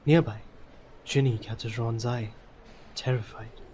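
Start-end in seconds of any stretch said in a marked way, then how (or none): none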